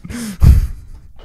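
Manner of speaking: loud high burst of laughter. Real, not acted. Lost the round.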